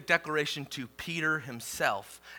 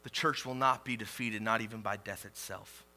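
ordinary talk, nothing marked

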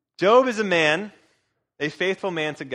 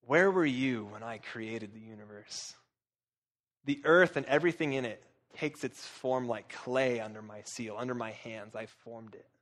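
low, soft voice of God, fading to very quiet; hushed solemn questioning; 5 semitones lower, 7 dB quieter than usual. Acted. God to Job about creation, hushed and low, fading away. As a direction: let it sink low, nearly vanishing by the end.

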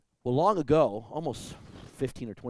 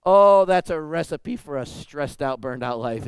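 loud exclamation; 3 semitones higher, 12 dB louder than usual. Real, not acted. Pastor exclaims to congregation.

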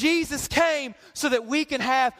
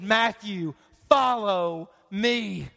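gentle call; 6 semitones lower, much slower than usual. Acted. Jesus calls Matthew.

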